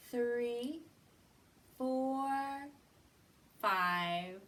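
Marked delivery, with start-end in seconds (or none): none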